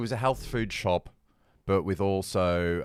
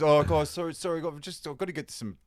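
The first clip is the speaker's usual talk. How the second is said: higher, a light hint of a flustered apologising barista; apologetic barista; 8 semitones higher than usual. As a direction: apologise in a rush.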